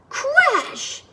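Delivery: sudden high exclamation; dramatic crash. A narrator's reading. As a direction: make the crash burst out. Sound effect in story.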